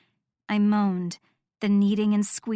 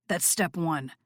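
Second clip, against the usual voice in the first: low, soft and dark; 4 semitones lower, 4 dB quieter than usual. Acted. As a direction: say it low and sly. Noah low.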